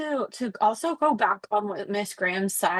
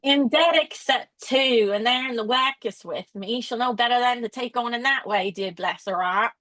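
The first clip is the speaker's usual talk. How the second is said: put-on terrible British accent. Teacher showing the class how the nurse would have sounded, in a bad British accent. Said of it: "that's how they would have said".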